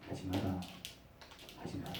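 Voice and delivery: whispered divine no; whispered warning. Acted. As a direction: hush it, firmly. God's voice whispering. (속삭이는 하나님의 경고)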